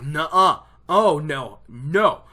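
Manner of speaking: loud, firm retold refusals; riled up. Lan riled by Nynaeve's blame, loud firm denial. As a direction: bat the objection away. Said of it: "riles Lan up".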